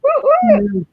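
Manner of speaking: very high-pitched whoop; real excited little cheer; celebratory cheer. Real, not acted. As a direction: whoop for the home state. Cheering mention of New Jersey.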